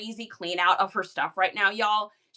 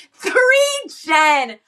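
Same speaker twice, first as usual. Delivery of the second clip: excited callout; 6 semitones higher, 11 dB louder than usual. Real, not acted.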